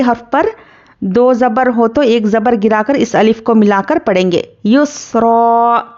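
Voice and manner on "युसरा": युसरा recited slowly, last vowel drawn out; slow recitation. Shown as a demonstration. Teacher models the verse ending for pupils, slow chant. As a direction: chant युसरा long and even. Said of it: "इस तरह से".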